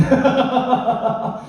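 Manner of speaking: high burst of laughter. Real, not acted. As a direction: laugh out at your own warning. Laughs after warning about being outcast. (높게 터지는 웃음)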